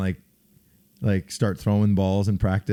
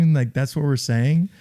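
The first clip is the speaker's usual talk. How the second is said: usual speaking voice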